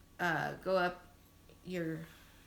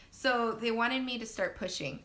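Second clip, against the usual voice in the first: ordinary talk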